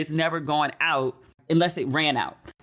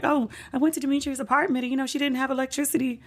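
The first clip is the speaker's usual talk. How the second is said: mom telling dad; 9 semitones higher than usual. Retold or imitated.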